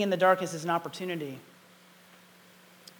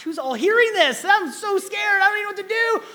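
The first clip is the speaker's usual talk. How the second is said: much higher, louder frantic inner voice; panicked thoughts; 14 semitones higher, 9 dB louder than usual. Retold or imitated. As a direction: panic about who's listening. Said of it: "Now I'm thinking".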